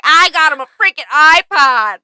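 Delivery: loud, high-pitched mock outburst; mock outraged yell. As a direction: imitate an indignant man yelling his complaint. Acts out Michael's angry outburst.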